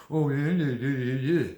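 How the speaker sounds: exaggerated mimicking jeer; mock them with a wordless-sounding run of yeahs. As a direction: make fun of someone by aping them.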